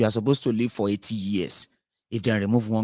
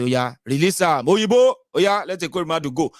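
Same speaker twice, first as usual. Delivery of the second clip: mocking protesters; 7 semitones higher, 6 dB louder than usual. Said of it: "So say".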